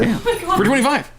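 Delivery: tickled high-pitched add-on; laughing. Real, not acted. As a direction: giggle the price out. High laughing hoodie price.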